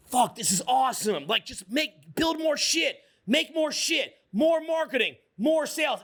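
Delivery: acted-out excitement, pitch climbing high; hyped-up enthusiasm. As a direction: fire off each demand like you're pumped to build. Acting out being pumped to work.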